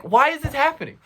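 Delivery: high, wailing question; desperate plea. Retold or imitated. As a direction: ask it in helpless, high-pitched dismay. Pleading with the doctor.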